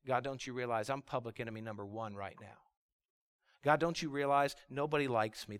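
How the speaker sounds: low, soft acted plea to God; subdued, hurt complaint; despairing lament. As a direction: lament quietly as a worn-down prophet.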